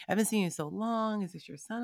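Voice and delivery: high, bright mock-gushing greeting; gushing neighbor. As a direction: play the overeager old neighbor lighting up. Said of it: "she's like".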